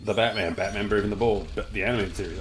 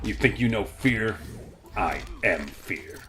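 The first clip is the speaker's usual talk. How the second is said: dramatic villain voice; slower than usual. Pretend. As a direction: drag it out like a villain. Quoting villain line dramatically.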